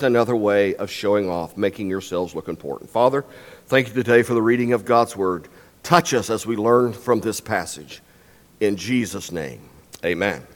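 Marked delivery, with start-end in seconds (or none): 2.9-10.5 soft, low closing prayer. Acted. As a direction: pray quietly over the reading. Closing prayer after the reading.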